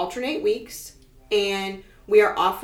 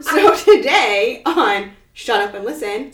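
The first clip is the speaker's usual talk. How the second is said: laughing; 3 semitones higher, 9 dB louder than usual. Acted. Laughing show intro.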